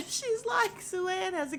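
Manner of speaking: real giggle breaking into the retelling; laughing. Real, not acted. Recapper retelling the cape joke to viewers, laughing.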